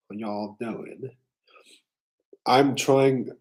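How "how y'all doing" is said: slow Southern drawl, stretched vowels; a tentative Southern accent. Pretend. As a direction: greet them like a Southerner. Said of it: "I'm trying a Southern accent right now".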